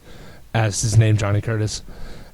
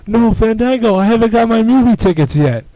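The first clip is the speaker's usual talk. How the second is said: higher, louder jeering voice; mock taunting; 9 semitones higher, 5 dB louder than usual. Scene: Jericho taunting Fandango as he walks away, high loud jeer. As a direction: taunt him with a high mocking call. Jericho's mocking retort quoted.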